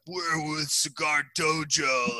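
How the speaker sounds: put-on slow, slurred drunk boast; imitating drunks pleading with a bouncer; drunken slur. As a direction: drag it out like a sloshed name-dropper. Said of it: "out of my mind".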